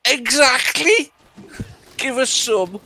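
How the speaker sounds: put-on high, drawn-out kid's reply; piping, knowing schoolboy impression; milk advert schoolboy. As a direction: draw it out in a loud little-boy voice. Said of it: "Ian Rush says".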